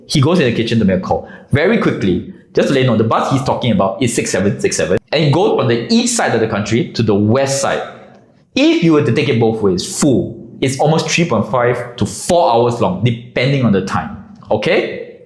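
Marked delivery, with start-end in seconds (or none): none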